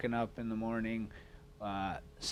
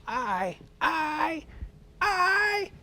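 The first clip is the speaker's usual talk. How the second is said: rising mocking drill instructor yell; barked sir yes sir; 5 dB louder, much slower than usual. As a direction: jeer it higher each time. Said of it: "drill instructors would flank around you".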